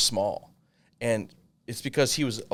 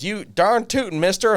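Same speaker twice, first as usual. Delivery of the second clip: higher, louder hammed-up cowboy twang; Texas kid; 10 semitones higher, 7 dB louder than usual. Texas kid answers his partner in a hammed-up cowboy twang. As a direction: belt it like a little cowboy. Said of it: "He goes".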